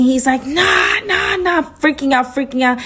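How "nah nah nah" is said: high-pitched panicky refusals; mock scream without full volume; retold screaming. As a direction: imitate him freaking out, pitch jumped up. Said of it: "he is screaming".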